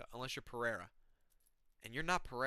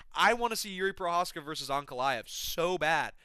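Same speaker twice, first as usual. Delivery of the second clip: ordinary talk, nothing marked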